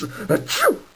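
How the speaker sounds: very high little sneeze. Acted. Sick engine sneezing.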